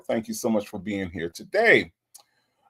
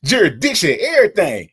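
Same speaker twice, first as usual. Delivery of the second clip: mock giddy chant, much higher and louder; imitating excited Nesto; 9 semitones higher, 7 dB louder, faster than usual. Host imitating Nesto's excited jurisdiction chant for viewers, giddy mock squeal. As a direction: squeal it like he's sure it frees him. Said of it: "did you hear how happy that Nesto got".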